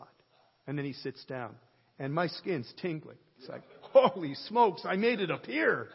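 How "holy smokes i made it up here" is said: mock amazed exclaim. Joking about his own vision.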